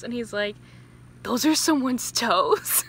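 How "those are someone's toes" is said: horrified hero. Retold or imitated.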